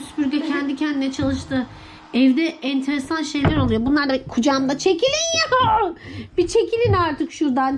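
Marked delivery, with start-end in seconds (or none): 4.8-7.8 exasperated shooing. Real, not acted. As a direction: shoo them off, stretching the words. Shooing pets off the lap.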